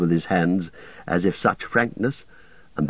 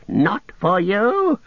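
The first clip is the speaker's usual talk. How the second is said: much higher and slow; defiant wail; 12 semitones higher, 3 dB louder, much slower than usual. A narrator's reading. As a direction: Gollum snarling at the east. Gollum defiant.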